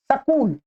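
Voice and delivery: सकूल with a vowel between s and k; Haryana pronunciation. Retold or imitated. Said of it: "Haryana की side में इसको क्या बोलते हैं". (हरियाणवी "सकूल", "स" और "क" के बीच "अ")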